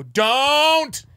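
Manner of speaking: high, loud shout of don't; one sharp holler to stop a car; yelled warning. Shown as a demonstration. Cyclist about to be hit in New York, yelled warning. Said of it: "I just yell".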